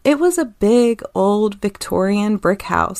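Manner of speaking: normal talking voice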